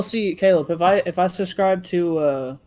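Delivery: ordinary delivery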